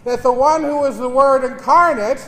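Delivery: normal talking voice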